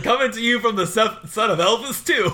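hammed-up Tom Hanks announcing; Tom Hanks impression. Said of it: "He's like".

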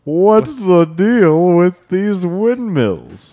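high, loud, drawn-out punchline; mock president punchline. Joke's president asks about windmills, high slow delivery.

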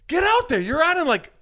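high-pitched mock urgency; urgent hurrying. Guard rushes the actor on stage, urgent. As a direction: send him out, acting frantic.

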